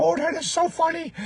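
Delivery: put-on high, amused Indian accent; giggly Indian accent. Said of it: "then he would just start giggling".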